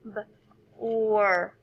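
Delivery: "B", pause, then "or"; each sound of "B, or" said alone. Shown as a demonstration. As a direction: split "B" from a held "or". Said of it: "Let's blend it again".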